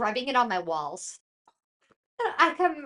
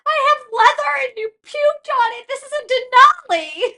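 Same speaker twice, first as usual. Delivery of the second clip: high, loud upset complaint; upset outburst; 13 semitones higher, 10 dB louder than usual. Retold or imitated. Mother to son who puked on leather, high and loud. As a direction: wail about the leather seats. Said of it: "I'm like".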